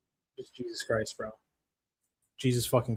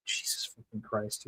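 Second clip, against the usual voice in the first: slow, much softer disgusted curse; exasperated mutter; 4 semitones lower, 12 dB quieter than usual. Real, not acted. Streamer groaning to chat after the upset, muttered. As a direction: sink into it, defeated.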